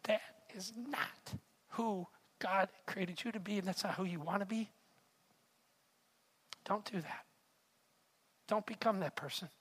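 soft, grave admonition with long pauses; hushed rebuke. Retold or imitated. Wife's quiet rebuke goes on.

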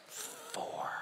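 tiny, barely audible whisper; very soft secretive whisper; child's whisper. Acted. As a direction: breathe it out like a hiding four-year-old. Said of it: "the kid's whispering all the way through".

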